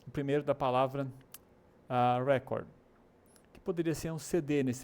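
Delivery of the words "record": "record" with a strong REC, the noun; first-syllable stress. Shown as a demonstration. Teacher introduces the noun for a disc, "record" stressed up front. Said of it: "maiúsculo significa ênfase".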